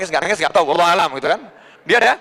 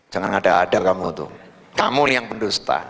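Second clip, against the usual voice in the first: very low, restrained royal warning; cruel king; 13 semitones lower than usual. Acted. King accuses the sufi of lying, low threat. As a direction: drop the pitch right down, menacing. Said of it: "Ini rajanya bengis, lalim gitu".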